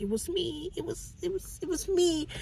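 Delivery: high, hushed, trembling near-tears voice; tiny scared voice close to crying; scared confession. Acted. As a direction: whimper it out like a frightened woman caught.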